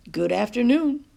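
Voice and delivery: friendly grandmother greeting; cheerful greeting. A narrator's reading. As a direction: greet the whole bus with a big smile. Nana greets everyone.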